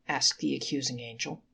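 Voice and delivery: ordinary delivery, nothing marked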